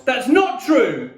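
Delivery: loud, high, slow denial; yelled. A narrator's reading. Mum denying her son's accusation, yelling. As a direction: yell it to contradict him. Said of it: "Mum yelled".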